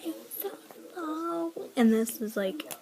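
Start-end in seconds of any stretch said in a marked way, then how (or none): 0.0-1.5 mocking girly voice. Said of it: "she was all".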